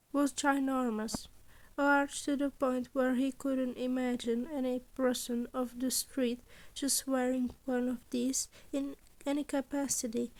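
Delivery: plain reading voice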